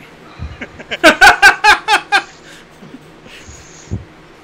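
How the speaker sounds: big high burst of laughter; loud laughter. Real, not acted. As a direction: crack up loudly.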